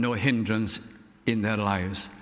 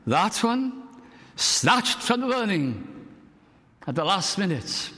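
fervent exclamation; 7 semitones higher than usual. Retold or imitated. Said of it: "Then be another one".

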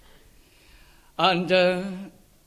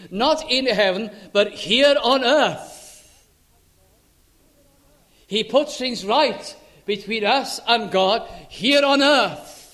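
full-voiced preaching, louder and rising; fervent preaching; 3 semitones higher, 7 dB louder than usual. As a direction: preach it with conviction to the congregation.

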